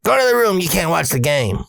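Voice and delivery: high, sharp imagined order; dad's feared scolding. Retold or imitated. Imagined dad sends son away from game, scolding. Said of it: "I'm afraid he's gonna be like".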